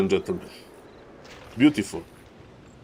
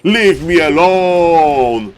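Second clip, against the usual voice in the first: loud, slow, pleading cry; mock desperate player; voicing the hunted player; 2 semitones higher, 6 dB louder, much slower than usual. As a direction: beg to be left alone, drawn out. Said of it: "He's like".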